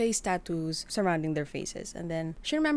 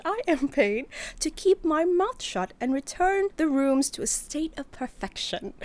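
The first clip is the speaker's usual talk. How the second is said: prim; 8 semitones higher, 4 dB louder than usual. Acted.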